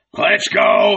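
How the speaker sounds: loud but reluctant order; half-hearted command. A narrator's reading. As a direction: call them off without heart. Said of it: "commanded them half-heartedly".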